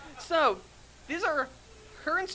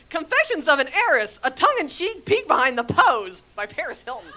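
sexy socialite heiress voice; 3 semitones higher, 8 dB louder than usual. Acted. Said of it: "I gotta put on my sexy voice, my sexy socialite heiress voice".

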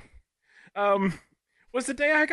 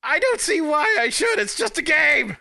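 loud higher yell at the game; 6 semitones higher, 5 dB louder than usual. Retold or imitated. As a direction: yell it in frustration. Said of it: "I yelled at the game".

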